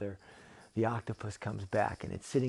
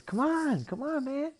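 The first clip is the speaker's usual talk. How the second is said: high, loud, excited creature voice; eager cartoon octopus; urging octopus; 6 dB louder than usual. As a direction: egg him on in a pitched-up shout. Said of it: "the octopus says".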